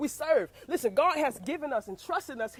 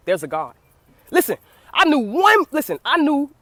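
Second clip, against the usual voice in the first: normal talking voice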